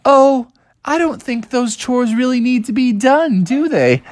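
put-on high coaxing young bear; smooth-talking Bubby. Bubby tries to talk Dad out of chores, high and coaxing. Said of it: "And Bubby was like".

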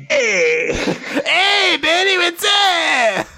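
high mock devil call. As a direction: hail him like a cartoon imp. Devil greeting in a put-on voice.

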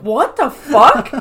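slow, laughing disbelief; baffled disbelief. Retold or imitated. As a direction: drag it out, baffled. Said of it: "It's like".

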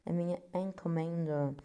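normal talking voice

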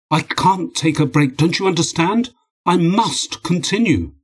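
high-pitched, agitated protest; desperate insistence. Acted. Husband refusing his wife's advice to rest, desperate insistence. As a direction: plead urgently, drawing out the last words. Dmitri refuses to take a break.

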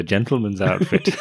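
usual speaking voice

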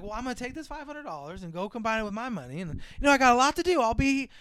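a hint of a con artist; lightly voiced scheming mom. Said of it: "she's like".

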